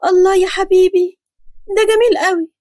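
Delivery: thrilled by the gift; delighted. Acted. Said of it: "فرحت قوي".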